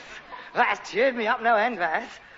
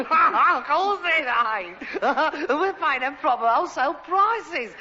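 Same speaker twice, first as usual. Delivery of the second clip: laughing; 6 semitones higher than usual. Acted. Laughing agreement.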